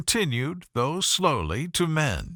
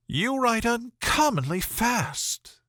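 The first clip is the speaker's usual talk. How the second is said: much higher, slow, cooing admiration; Miss Bingley admiring; 9 semitones higher, slower than usual. A narrator's reading. Miss Bingley admiring Darcy's writing, cooing and high. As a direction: coo the compliment at him.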